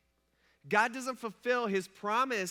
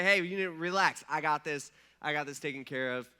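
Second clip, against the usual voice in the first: reassuring God; 7 semitones lower than usual. Pretend. Imagined God reassuring David.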